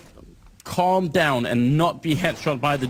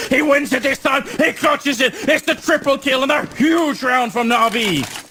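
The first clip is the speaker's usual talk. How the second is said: higher excited clutch play-by-play; esports caster; 5 semitones higher, 3 dB louder than usual. Real, not acted. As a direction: ride the clutch with building hype.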